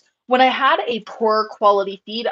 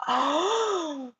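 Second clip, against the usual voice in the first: soft, high, drawn-out swoon; overwhelmed "oh"; 7 semitones higher, 6 dB quieter than usual. Retold or imitated. Breeder swooning at mentor's compliment, overwhelmed. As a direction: melt into a long high "oh". Gasping reaction to mentor's praise.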